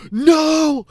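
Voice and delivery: sudden high yelled No, full panic; terrified yell. Retold or imitated. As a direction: scream it as if you just saw a ghost. Panicked scream at the mirror.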